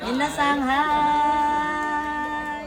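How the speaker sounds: high, loud, long drawn-out call. Real, not acted. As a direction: stretch the last word out to the group. Calling out to the whole group.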